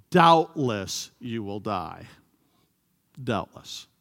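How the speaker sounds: slow, mocking "Doubtless" drawn out; sarcastic serpent. Serpent mocks Eve about God's warning, slow and sarcastic. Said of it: "here's where Satan uses sarcasm".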